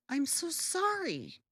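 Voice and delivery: ordinary talk, nothing marked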